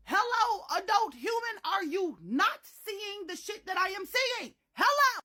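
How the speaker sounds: high, loud sarcastic hello; exasperated. Retold or imitated. Child demanding the lady notice, loud exasperated calls. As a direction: demand attention in disbelief.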